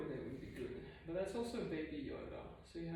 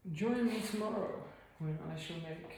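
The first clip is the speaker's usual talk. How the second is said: ordinary talk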